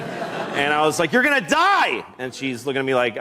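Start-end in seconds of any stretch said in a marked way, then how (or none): 1.1-2.1 high-pitched wail of alarm; re-enacted frantic warning, pitched high; panicked warning. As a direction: cry it out in comic panic. Panicked owner warns his dog.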